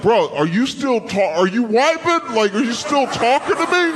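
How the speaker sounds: loud, high-pitched incredulous retold complaint; exasperated disbelief. Man in the story to the one still thanking him, exasperated. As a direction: act out someone who can't believe this is still going.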